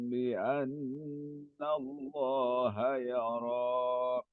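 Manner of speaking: slow melodic Quranic chanting; Quran recitation. Acted. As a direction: recite slowly in tajweed melody. Student recites Quran verses.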